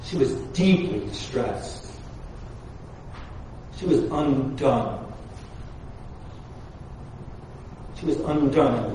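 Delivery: soft, low, slowed telling of her grief; hushed. Acted. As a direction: drop right down to a hush. Hushed sermon moment.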